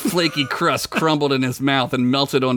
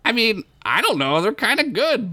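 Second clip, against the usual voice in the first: high, unsure little voice; a small hero hedging nervously; timid Bilbert; 8 semitones higher than usual. Acted. Bilbert timidly defends fries.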